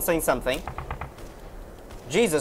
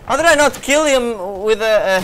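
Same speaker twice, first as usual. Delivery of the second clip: raised, loud voice of protest; exasperated disbelief; 5 semitones higher, 7 dB louder than usual. Real, not acted. Gamer complaining that his sniper did not kill, exasperated. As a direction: protest loudly at the game.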